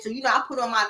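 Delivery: ordinary talk, nothing marked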